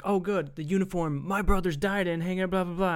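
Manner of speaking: mock snide corporal. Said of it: "He's like".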